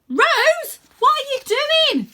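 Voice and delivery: very high, loud panicked cry; boy crying out as Rose stands on the wing; horrified shout. Acted. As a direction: scream the question in alarm.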